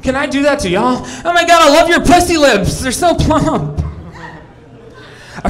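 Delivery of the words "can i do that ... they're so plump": mock valley girl gushing over eyelashes. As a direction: squeal over it like an excited fan.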